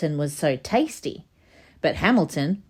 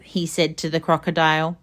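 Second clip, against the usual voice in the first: plain reading voice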